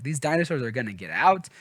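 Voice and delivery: normal talking voice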